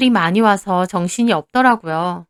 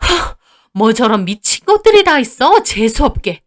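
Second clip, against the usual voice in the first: scornful outrage; 4 dB louder than usual. Acted. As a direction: insult thrown at their backs. Mother-in-law insults departing sisters. (어이없다는 듯 쏘아붙이는 말투)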